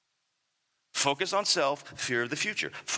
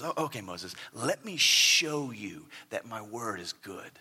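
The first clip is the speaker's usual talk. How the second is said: gracious God; 8 semitones lower, 5 dB quieter than usual. Acted. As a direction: reassure Moses gently as God. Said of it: "God very graciously says to Moses".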